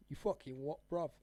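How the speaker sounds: mock London geezer, tough; British slang voice. Shown as a demonstration. Streamer shows off his British slang, mock British accent. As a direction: put on a cheeky British accent. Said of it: "I can do a lot of slang".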